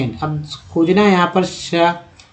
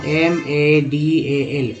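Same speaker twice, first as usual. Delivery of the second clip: very slow letter-by-letter spelling; much slower than usual. Shown as a demonstration. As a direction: drag out each letter for learners. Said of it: "समझाने के लिए मैं बता रहा हूँ". (बहुत धीरे अक्षर-अक्षर बोलना)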